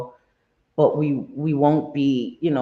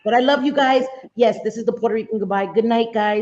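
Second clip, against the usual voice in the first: loud, high cheerful sign-off; excited goodbye; 7 semitones higher, 4 dB louder than usual. Real, not acted. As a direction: gush the goodbye to the chat. Puerto Rican goodbye sign-off.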